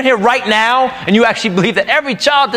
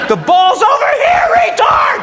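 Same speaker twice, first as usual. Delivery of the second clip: shout. Retold or imitated. Angry dad screaming at his kid's team from the sideline.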